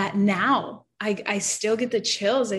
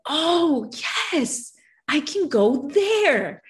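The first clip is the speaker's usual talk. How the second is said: a high, slow, wondering "oh, yes"; dawning realization; 7 semitones higher, slower than usual. Retold or imitated. To Jesus, realizing where home is, in wondering relief. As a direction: let the relief break through as it dawns. Retold moment of sudden realization.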